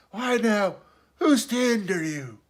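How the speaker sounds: high castaway voice, perking up slyly; startled slyness. A narrator's reading. Castaway repeating the boy's words, startled and sly. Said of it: "perked up in a kind of startled slyness".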